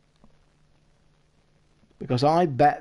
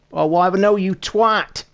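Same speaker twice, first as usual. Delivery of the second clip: raised, louder scolding; exasperated outburst; 7 semitones higher, 6 dB louder than usual. Real, not acted. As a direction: snap it out, higher and louder. Scolds over missing what was there.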